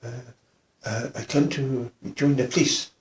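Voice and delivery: soft, hesitant voice; quietly spoken. Retold or imitated. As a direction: imitate a timid, soft-spoken man. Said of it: "he was very quietly spoken".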